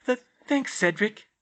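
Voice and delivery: high-pitched, flustered stammer; embarrassed, halting thanks. A narrator's reading. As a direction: trip shyly over the first word. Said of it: "she stammered".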